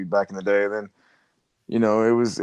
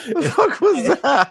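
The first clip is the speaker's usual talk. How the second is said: laughing; 6 dB louder, slower than usual. Real, not acted. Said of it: "laughing at the gobble".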